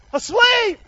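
high, loud preacher's exclamation. Shown as a demonstration. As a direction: cry the word out high to the congregation. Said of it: "Say it louder".

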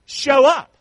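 loud preached refrain. Real, not acted. Preacher drilling the refrain into the church, loud. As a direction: belt out the refrain.

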